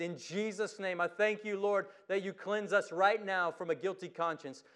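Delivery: earnest spoken prayer voice. Acted. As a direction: pray it steadily to the Lord. Pastor leads closing prayer.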